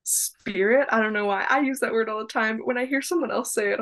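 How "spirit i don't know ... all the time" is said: ordinary delivery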